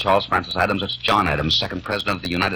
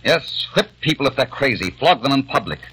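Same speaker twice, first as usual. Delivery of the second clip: angry outburst; 4 semitones higher than usual. Acted. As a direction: lash out at them in contempt. Peter rages at the family.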